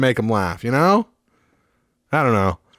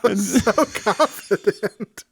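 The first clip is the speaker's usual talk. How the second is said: wheezing after a laughing fit; breathless, squeaky leftover laugh; wheezy voice; 6 semitones higher, much slower than usual. Real, not acted.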